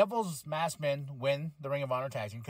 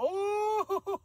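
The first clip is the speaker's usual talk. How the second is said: high squealing laugh of delight; a burst of giddy laughter; excited squeal-laugh. Real, not acted. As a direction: squeal with glee at the move. Amazed at the finish.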